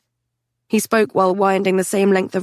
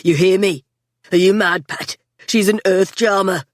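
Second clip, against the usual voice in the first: blustering; 3 dB louder than usual. A narrator's reading. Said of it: "Scotty blustered".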